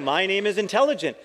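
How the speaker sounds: lightly voiced proud learner; earnest mistake. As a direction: deliver it proudly, unaware of the joke. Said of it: "So I'm saying".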